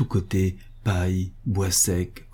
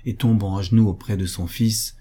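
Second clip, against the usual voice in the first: ordinary delivery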